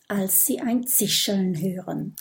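ordinary delivery

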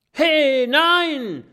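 hoch und laut geschrien; Schrei. Acted. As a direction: panischer Warnschrei an den Büffel. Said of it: "schreit Kokosnuss". (laut und hoch schreiend)

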